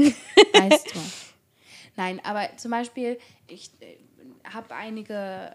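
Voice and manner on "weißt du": mock snooty voice. Mock posh aside.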